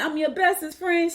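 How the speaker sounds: high, lilting disbelief; surprised question. Retold or imitated. Grandmother answering the toddler's compliment, surprised.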